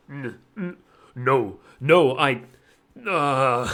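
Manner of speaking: deep, stammering, flustered voice; deep stammering president. Shown as a demonstration. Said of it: "has to stay as deep".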